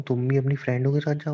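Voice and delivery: ordinary talk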